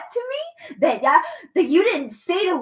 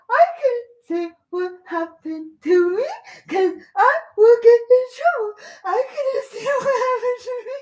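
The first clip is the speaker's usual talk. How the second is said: mocking whine that climbs higher and louder into a sob; mock crying; 6 semitones higher, 4 dB louder than usual. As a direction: parody their words as a crybaby, half laughing. Mocking someone's words, fake crying.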